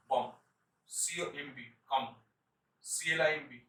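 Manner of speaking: ordinary talk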